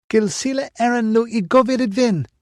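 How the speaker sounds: high, carrying call in a foreign tongue; calling out in Elvish. Acted. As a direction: sing it out high to announce yourself. Ritva calls out in Elvish.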